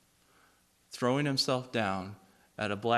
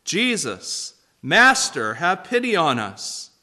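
loud, raised pleading cry; loud call for pity; 5 semitones higher, 8 dB louder than usual. Acted. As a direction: call out from a distance, begging. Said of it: "called out in a loud voice".

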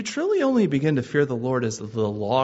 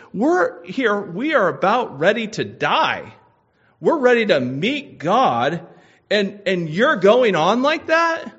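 climbing higher and louder to a shout; incredulous rebuke; 6 semitones higher, 6 dB louder than usual. Acted. Dying thief scolds the other thief, building to a shout.

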